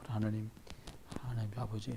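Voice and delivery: near-silent murmured prayer; barely audible prayer. Retold or imitated. Father praying over his sleeping kids, almost inaudible. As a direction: pray so softly no one can hear. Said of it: "들리게 기도해요".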